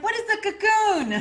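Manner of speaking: put-on high, loud puzzled Argentinian-accent question; Argentinian healer asking. Argentinian healer questioning her client, puzzled. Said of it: "really strong ... kind of accent".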